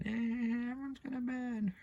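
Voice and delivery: very high, eerie granny impression; sinister old woman. Imitating the grandma as she starts laughing, high and sinister. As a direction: screech it high like a possessed grandma. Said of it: "Proper sinister, isn't she?".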